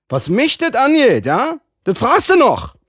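hoch und laut, fassungslos wütend; empörter Mann. Acted. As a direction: explodier vor Empörung. (hoch, laut, empört)

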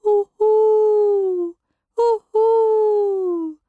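high, loud drawn-out owl hoo; a little owl's loud crying hoo; owl howling. A narrator's reading. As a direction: howl it long and high like a wailing owlet. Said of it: "ein schreckliches Heulen".

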